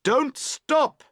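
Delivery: high, urgent shout. A narrator's reading. Boatman to girls towing, shouting. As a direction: shout it out to people at a distance. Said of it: "You shout to them".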